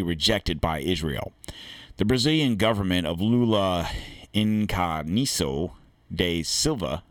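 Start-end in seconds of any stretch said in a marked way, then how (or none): 4.3-7.0 Inacio da Silva said slowly, syllable by syllable; slow careful try at a foreign name; careful Portuguese name. Shown as a demonstration. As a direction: sound out Inacio da Silva with care, Portuguese style. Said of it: "I think I said that right".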